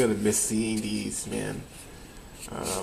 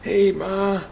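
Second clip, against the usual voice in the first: a hint of an old man, higher and louder; lightly put-on old-man voice for a prank; disguised old man voice; 10 semitones higher, 6 dB louder than usual. Kid prank calling mom, lightly disguised as an old man. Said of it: "disguise my voice, ... sound like an old man".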